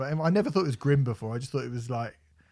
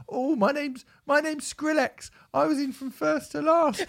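very high whiny mock voice; 12 semitones higher than usual. Host mocks [name]'s fake punk claims, squeaky. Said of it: "pretending that you go".

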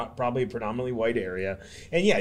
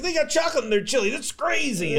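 high squeaky disbelief; mock shock; 12 semitones higher than usual. Mocking locals amazed by chocolate in chili, high mock shock. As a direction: pitch way up, act amazed at the idea.

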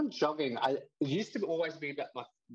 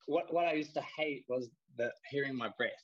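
ordinary talk, nothing marked

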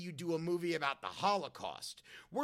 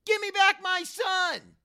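high, drawn-out doorstep yell; Mel impression; 11 semitones higher, 10 dB louder than usual. Kid at a Halloween doorbell doing the impression, full high yell. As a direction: yell it slow and loud instead of trick or treat. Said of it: "I would ring the doorbell and yell".

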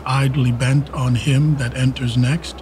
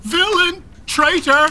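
high, raging outcry; outraged cry; 11 semitones higher, slower than usual. Acted. Father reading the plot letter, shrieking in rage. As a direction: hurl each word in fury.

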